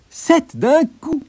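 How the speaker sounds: high, slow exclamation of triumph; excited exclamation. A narrator's reading. Tailor marvels at seven flies killed in one blow, exclaiming. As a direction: cry it out high, proud of the feat. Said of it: "s'exclama-t-il".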